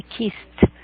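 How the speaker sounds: "Kissed" as one syllable, kist; "Kissed" with no e in the ending. Shown as a demonstration. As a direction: close "Kissed" with a crisp t. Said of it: "suena como una T".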